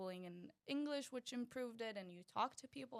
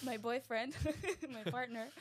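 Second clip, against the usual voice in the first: laughing; 4 semitones higher, 5 dB louder than usual. Real, not acted. Laughing through restart.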